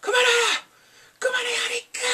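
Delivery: high, wailing urging; hammed-up frustrated plea; mock exasperation. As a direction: cry it out high, egging on a drama character. Acts out exasperation at the character.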